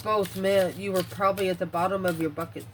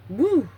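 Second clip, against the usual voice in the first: high, loud whoop; whooped "woo"; 6 semitones higher, 7 dB louder than usual. Real, not acted. Reader reacts to someone pushing your face down, whooping. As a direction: let out a sudden loud "woo!". Exclaims at imagined face-push.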